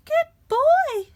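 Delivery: high, loud mum with a gasp; Jack's mum, breathless with delight. A narrator's reading. Mum gasping at the gold coin, high and loud. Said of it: "she gasped".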